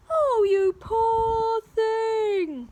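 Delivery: slow, loud, high sympathetic giantess; booming giantess. Acted. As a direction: boom it out with pity. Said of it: "boomed the giantess".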